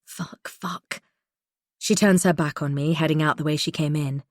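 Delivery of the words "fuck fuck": unvoiced breathy curses under her breath; muttered curse. A narrator's reading. Holly spots the camera, muttering curses. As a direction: mutter it to yourself, rattled. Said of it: "she mutters".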